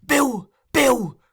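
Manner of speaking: very high, loud calls; urgent cry. A narrator's reading. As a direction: call after someone leaving in haste.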